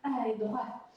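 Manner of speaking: normal talking voice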